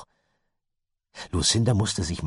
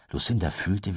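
usual speaking voice

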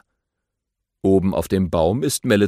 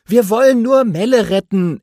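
higher, eager little hero voice; friendly young aardvark, voiced higher; Tafiti voice; 9 semitones higher, 6 dB louder than usual. A narrator's reading. Tafiti politely asks the grumpy badger for help, eager and high.

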